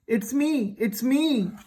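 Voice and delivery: high, soft little voice; lightly voiced storybook pig. A narrator's reading. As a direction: reveal yourself softly and high. Pig reveals itself in story.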